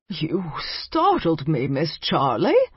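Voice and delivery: put-on slow, wheezy old vampire voice; an elderly vampire muttering in surprise; wheezy old man. A narrator's reading. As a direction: drag the words out like a frail old man. Startled old vampire answers.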